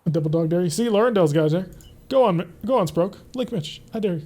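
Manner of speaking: ordinary delivery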